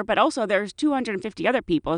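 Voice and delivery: usual speaking voice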